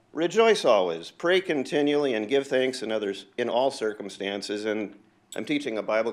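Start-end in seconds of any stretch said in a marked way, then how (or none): 0.1-4.7 louder, fervent verse proclamation; preaching scripture. Acted. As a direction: preach it out with conviction. Quotes scripture as a message.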